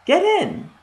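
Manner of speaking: very high shrill witch command. A narrator's reading. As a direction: snap it like a witch. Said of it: "said the witch".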